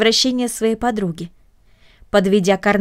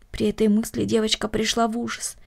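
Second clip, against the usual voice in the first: usual speaking voice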